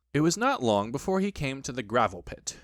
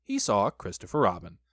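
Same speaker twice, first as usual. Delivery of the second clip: ordinary delivery, nothing marked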